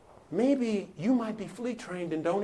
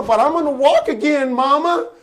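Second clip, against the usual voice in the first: put-on loud, higher voice of an insistent boy; boy protesting to mama; 8 semitones higher, 11 dB louder than usual. Johnny tells Mama his hope, loud high protest. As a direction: plead upward as a child, loudly.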